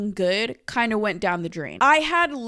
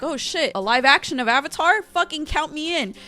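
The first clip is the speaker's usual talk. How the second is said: higher-pitched hyped-up fan reaction; retold excitement; 6 semitones higher, 4 dB louder than usual. Fan hearing of a live-action Avatar, retold excitement. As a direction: act out being totally on board. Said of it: "we were really excited".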